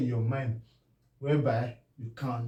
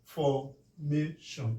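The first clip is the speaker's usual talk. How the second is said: formation, drawn out syllable by syllable; slow clear model of formation; slow demo word; 3 dB quieter, much slower than usual. Shown as a demonstration. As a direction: say formation very slowly. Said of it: "Now look at".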